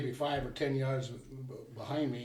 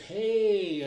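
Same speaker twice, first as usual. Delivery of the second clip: loud, higher-pitched drawn-out call; retold holler; 8 semitones higher, 8 dB louder than usual. Hunter yelling at the bear on his dad, retold holler. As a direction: imitate hollering to scare off a bear. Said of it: "hollered just as loud as I could".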